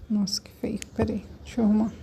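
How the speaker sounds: soft, barely voiced self-talk; muttered aside. Real, not acted. Teacher fixing her ugly writing, muttered aside. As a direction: drop to a near whisper, as if to yourself.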